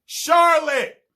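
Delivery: high, loud drawn-out yell; screamed name. Retold or imitated. Bean behind the door screaming a girl's name, high and loud. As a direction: wail the name out in passion. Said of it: "sounds like it's being screamed by Bean".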